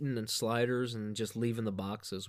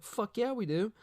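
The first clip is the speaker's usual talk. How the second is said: high, excited mock yes; eager yes; 10 semitones higher than usual. Strangers offer their table, he accepts eagerly and high. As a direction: jump at the offer. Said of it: "I was like".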